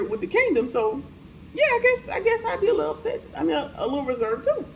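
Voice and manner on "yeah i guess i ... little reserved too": much higher, mock-innocent sarcastic comment; sarcastic squeak. Real, not acted. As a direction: play it sarcastic in a high squeaky voice. Sarcastic own comment on Madam.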